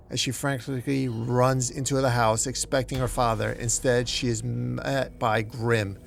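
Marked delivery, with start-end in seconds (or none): none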